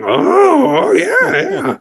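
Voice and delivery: very high squeaky goblin cheers; goblin cheering. Acted. Nearby goblins approving the party's rhyme, high cheering. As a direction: cheer like excited little goblins.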